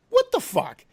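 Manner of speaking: usual speaking voice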